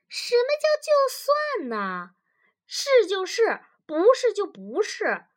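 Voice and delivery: loud, very high, emphatic objection; indignant insistence. Acted. Wife refuses husband's half concession, indignant. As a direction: pounce on it, voice shooting up.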